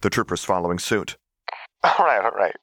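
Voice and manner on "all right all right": settling down while still giggling; through giggles. Acted. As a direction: try to stop laughing and fail. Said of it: "said between bouts of giggles".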